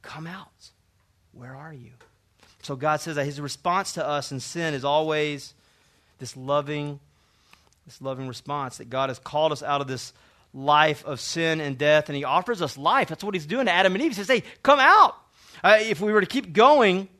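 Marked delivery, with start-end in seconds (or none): 0.0-1.9 very soft, hushed fatherly invitation; tender call. Acted. Beautiful response of the Father. 14.3-15.2 loud, high summoning call. Acted. God calling out to Adam, Eve.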